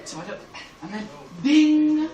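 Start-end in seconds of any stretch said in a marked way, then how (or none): 1.4-2.1 high, loud imitated bell; bright idea "ding". Comic getting the hint at the airline desk, chiming loudly. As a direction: ring out a sudden-idea chime.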